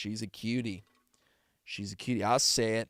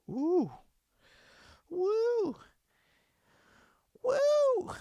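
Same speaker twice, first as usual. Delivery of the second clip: high, rising falsetto hoots; falsetto whoops; 9 dB louder, much slower than usual. Real, not acted. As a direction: whoop higher and higher. Sudden hooting.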